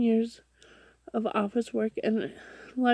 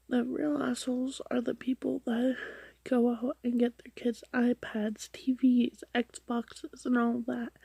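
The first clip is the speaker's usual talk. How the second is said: whispering; 3 semitones higher, 3 dB quieter than usual. Real, not acted. Whispered PSA commentary to parents.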